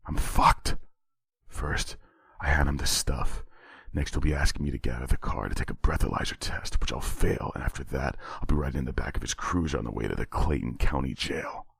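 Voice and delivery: inner thoughts. A narrator's reading. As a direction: murmur it to yourself, dreading it.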